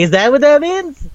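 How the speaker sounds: high, loud disbelieving question; mock baffled squeal; confused question. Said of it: "And it's like".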